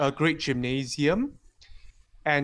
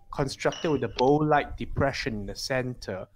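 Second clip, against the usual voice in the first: ordinary talk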